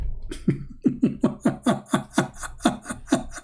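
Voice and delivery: long, breathy, wheezing laugh; real mocking laughter. Real, not acted. Speaker ridicules their claimed victory, real mocking laughter.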